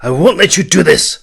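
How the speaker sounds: high, loud defiant refusal; loud protest. A narrator's reading. Prince refusing to let his sister do this, loud. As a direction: plant your feet and refuse her. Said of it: "he protested".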